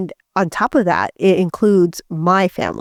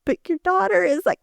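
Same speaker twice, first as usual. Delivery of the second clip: mock shocked friends; 10 semitones higher, 3 dB quieter, faster than usual. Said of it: "I'm sure they're like".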